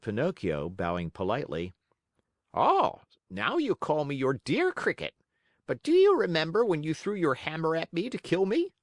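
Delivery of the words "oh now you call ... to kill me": high, tart tiny voice; reproachful cricket. Acted. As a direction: remind him of his cruelty.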